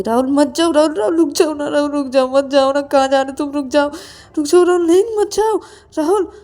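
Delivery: loud, high desperate calling; urgent pleading. Acted. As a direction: beg someone loudly not to go. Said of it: "Rahul को मना करता है कि". (ज़ोर से, ऊँची आवाज़ में गिड़गिड़ाकर रोकना)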